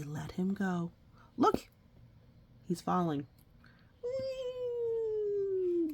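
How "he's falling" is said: usual speaking voice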